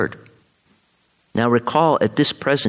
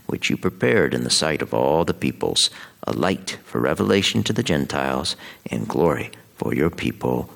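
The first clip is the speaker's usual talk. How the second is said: scripture reading; 4 semitones lower than usual. Acted. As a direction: read it as a proclamation at Mass.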